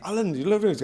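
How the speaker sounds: put-on a light touch of a little girl's voice; child's question. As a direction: ask it like a little girl. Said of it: "She was like".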